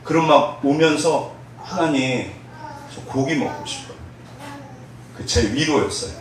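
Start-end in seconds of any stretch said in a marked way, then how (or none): 1.6-4.0 soft hushed plea for meat; quiet prayer. Retold or imitated. Hungry trainee softly asking God for meat, hushed. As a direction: murmur it like a tired, hungry prayer.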